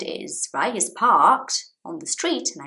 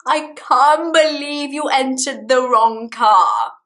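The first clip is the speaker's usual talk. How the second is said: husband teasing; 6 semitones higher, 6 dB louder, slower than usual. Retold or imitated. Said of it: "he goes".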